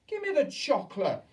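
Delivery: put-on high, loud boy's shout. A narrator's reading. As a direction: demand it at the top of his voice. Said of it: "shouted Bruno".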